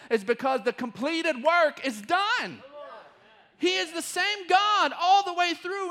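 rising sermon fervor, loud; preaching climax. Acted. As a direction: drive the point home to the congregation.

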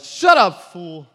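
loud, high, scornful yell; angry shout. Retold or imitated. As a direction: snap at the beggar to shut up. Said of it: "And someone shouted".

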